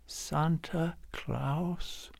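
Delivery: put-on tiny, wondering little child voice; baby's first words. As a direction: say it like a baby trying a first word.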